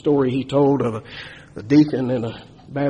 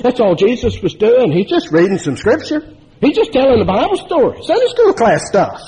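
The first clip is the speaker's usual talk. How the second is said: indignant rising emphasis; 7 semitones higher, 3 dB louder than usual. Real, not acted. Preacher rising in protest.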